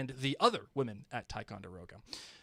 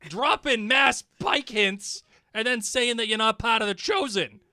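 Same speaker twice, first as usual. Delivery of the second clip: loud, high playful rant; comic indignant tirade, hitting words hard; mock accusation; 6 semitones higher, 10 dB louder than usual. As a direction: call out the culprit in hammed-up outrage. Mock accusation aimed at Melinda.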